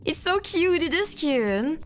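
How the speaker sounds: ordinary delivery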